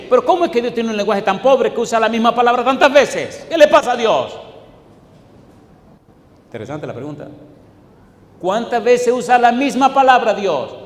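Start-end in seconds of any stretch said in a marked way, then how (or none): 0.1-4.4 loud, high-pitched exasperated outburst; mock indignation. Preacher challenging God's word choice before congregation, mock indignant. 8.4-10.7 high, loud incredulous question; mock exasperation. Preacher playing a reader tired of repetition, mock exasperated. Acting out a baffled, protesting reader.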